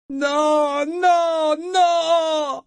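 very high, drawn-out wail of grief; heart-rending howl. A narrator's reading. Mother told her daughter perished, heart-rending howl. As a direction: howl each word from the throat. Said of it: "le cri monta de la gorge de Kefria, un hurlement déchirant".

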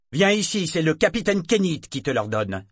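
crié, plus aigu et plus fort; ordre crié. Acted. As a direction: aboie un ordre de capitaine. Capitaine donne un ordre crié. (ordre crié)

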